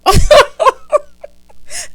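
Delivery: loud, high-pitched laughter; real big cackle. Real, not acted. Laughing at own imagined towel scene, loud cackle.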